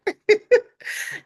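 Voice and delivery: loud, high burst of laughter. Real, not acted. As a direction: crack up at your own slip. Laughing at own stumble.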